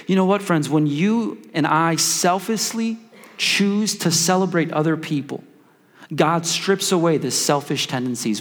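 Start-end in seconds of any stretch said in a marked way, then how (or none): none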